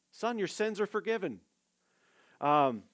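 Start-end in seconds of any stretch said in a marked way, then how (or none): 0.1-1.4 Son, your sins are forgiven said higher and firmer; higher, emphatic repeat. Shown as a demonstration. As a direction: proclaim the words of forgiveness. Preacher repeats Jesus's line.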